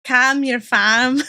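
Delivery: amateur Scouse copy, drawn out; Liverpool accent attempt. Shown as a demonstration. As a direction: drag it out in an exaggerated Liverpool accent. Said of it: "How to do a Liverpool accent".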